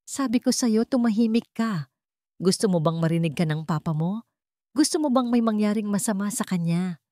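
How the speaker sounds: usual speaking voice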